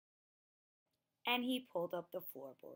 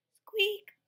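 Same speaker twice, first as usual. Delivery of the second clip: a high, loud mouse squeak; piercing high squeak; 13 semitones higher, 11 dB louder than usual. Acted.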